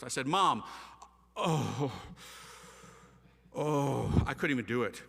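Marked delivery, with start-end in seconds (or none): 0.3-4.2 groaning, halting false start; struggling to say it. Retold or imitated. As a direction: act out choking on a confession to Mom. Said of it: "I couldn't do it at first".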